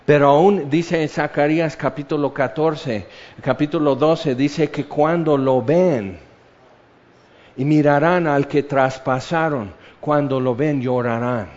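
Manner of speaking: ordinary talk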